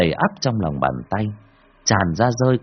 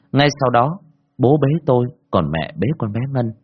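usual speaking voice

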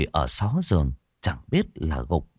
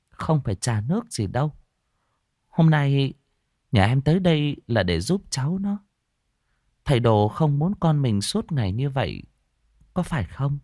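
higher, gentle voice of a village healer; older woman; 7 semitones higher than usual. Acted. Mị brushes off the tea, gently firm. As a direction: wave off the fuss and get to business kindly. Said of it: "Bà xua tay".

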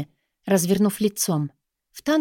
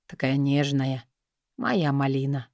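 tender; 4 semitones lower than usual. A narrator's reading. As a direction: murmur it lovingly. Lover speaks tenderly.

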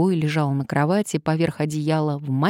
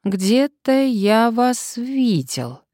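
slow, higher-pitched pondering drawl; drawn out; 7 semitones higher, 4 dB louder, much slower than usual. A narrator's reading. Patient trying to recognize his visitor, drawling. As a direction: stretch it while trying to place the face. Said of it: "протянул Сарычев".

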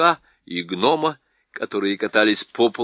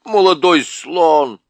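loud, high angry dwarf shout; 6 semitones higher, 7 dB louder, slower than usual. A narrator's reading. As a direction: yell at him to get off. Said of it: "сердитый голос".

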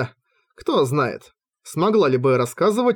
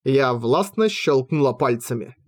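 ordinary delivery, nothing marked

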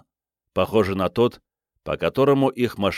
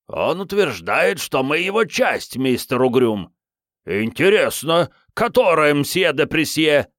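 voices in his head; 7 semitones higher, 3 dB louder than usual. Acted. Heads in his head bicker.

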